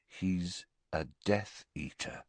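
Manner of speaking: hushed, slow realisation; quiet, drawn-out dawning thought; slowly. A narrator's reading. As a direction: say it slowly and very softly, as it dawns. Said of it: "said Harry slowly".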